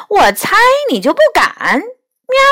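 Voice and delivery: high-pitched cat's taunt ending in a meow; haughty little dare with a high mew; scornful taunt. A narrator's reading. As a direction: goad them, voice lifted high. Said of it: "梨花恨了一声说".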